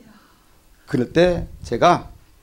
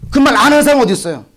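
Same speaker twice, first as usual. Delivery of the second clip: higher and louder, 하는 pushed; louder repeat; 8 semitones higher, 4 dB louder, faster than usual. Real, not acted. Preacher pressing the congregation, louder repeat. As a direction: hit it harder the second time. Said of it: "repeated louder".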